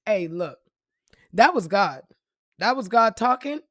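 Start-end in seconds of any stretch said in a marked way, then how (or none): none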